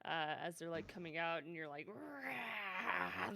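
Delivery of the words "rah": monster roar. Retold or imitated. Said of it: "not afraid to voice monsters".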